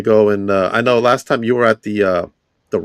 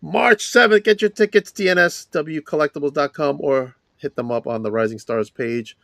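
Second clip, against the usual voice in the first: hype promo read; 5 semitones higher than usual. Acted. Hyped event promo read.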